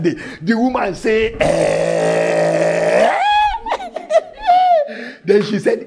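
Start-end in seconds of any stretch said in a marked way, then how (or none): 1.4-3.2 astonished eh. Acted. Woman's astonished reaction. 3.2-5.2 preacher cracking up at his own story. Real, not acted. Preacher's loud laugh.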